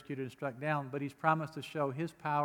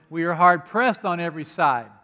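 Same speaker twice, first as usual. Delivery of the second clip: forceful scripture read; 2 semitones higher, 10 dB louder than usual. Acted. Preacher reading scripture forcefully.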